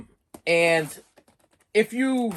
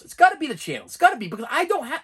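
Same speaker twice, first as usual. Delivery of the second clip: higher, louder, fast insistence; emphatic insistence; 11 semitones higher, 5 dB louder, faster than usual. Real, not acted. Blaming the streaming channels, emphatic and high. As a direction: insist it's gotta be the channels.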